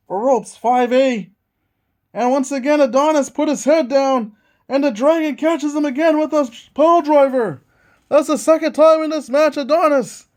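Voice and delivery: hyped play-by-play call; wrestling commentator. Acted. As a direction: call the match like a ringside announcer.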